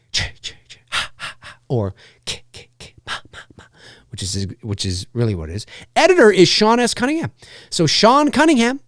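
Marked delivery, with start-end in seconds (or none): 0.1-3.6 breathy horror whisper effect. Retold or imitated. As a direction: whisper the killer's sound cue. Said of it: "I much prefer the early Friday the thirteenth music".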